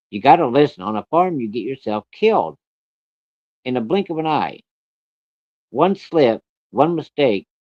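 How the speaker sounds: stern warning. Retold or imitated. Acted mom warns child of danger.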